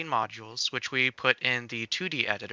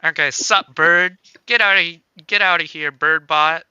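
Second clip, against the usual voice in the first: loud, brash cheeky greeting; cheeky flirt; 4 semitones higher, 12 dB louder than usual. Pretend. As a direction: hit on the bird with swagger. Reading flirty character lines.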